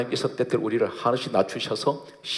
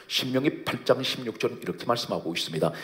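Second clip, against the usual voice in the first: ordinary talk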